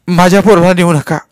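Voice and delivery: loud, high mother's wail; distraught mother at full pitch; screaming plea. A narrator's reading. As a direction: cry out desperately for your child. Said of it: "आरडू ओरडू लागली".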